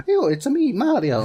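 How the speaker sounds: sudden very high, bouncy plumber voice; Mario voice. Retold or imitated. Retelling how the actor first auditioned, in a high cheerful cartoon plumber voice. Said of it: "just walked in and went".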